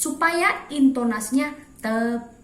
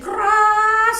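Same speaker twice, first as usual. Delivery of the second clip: loud; 10 semitones higher, 6 dB louder than usual. Shown as a demonstration. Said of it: "tanpa dinamika".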